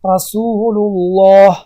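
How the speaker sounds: "رسول الله" drawn out very slowly, the lam of الله full and heavy; slow Quranic demo with a heavy lam on الله; heavy lam in Allah. Shown as a demonstration. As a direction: recite it slowly as a tajweed model, thick lam in الله. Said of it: "دیدین؟".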